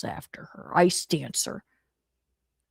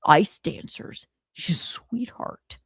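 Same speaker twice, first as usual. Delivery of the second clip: Ice Dancer impression; slower than usual. Imitating Ice Dancer with a whispered, slowed voice. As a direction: breathe it out slowly like a secret compliment. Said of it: "Ice Dancer didn't want this".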